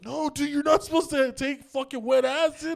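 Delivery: high, loud, incredulous telling-off; high-pitched exasperated protest; exasperated scolding. Retold or imitated. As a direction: imitate an appalled cousin scolding. Cousin scolds him about wet acid.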